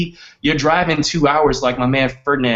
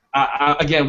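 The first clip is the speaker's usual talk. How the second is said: ordinary talk, nothing marked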